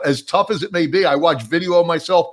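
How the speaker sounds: ordinary talk, nothing marked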